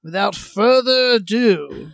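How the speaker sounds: loud, projected announcer attempt. Shown as a demonstration. Host retrying the announcing voice, loud. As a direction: boom out the introduction. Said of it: "I've lost my announcing voice".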